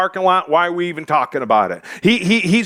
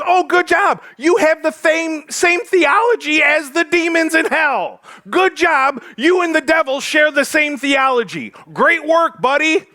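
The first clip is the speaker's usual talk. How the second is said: sarcastic two thumbs up; 8 semitones higher than usual. Pretend. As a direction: congratulate him sarcastically. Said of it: "that sarcastic two thumbs up".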